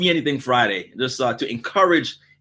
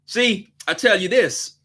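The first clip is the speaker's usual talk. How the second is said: usual speaking voice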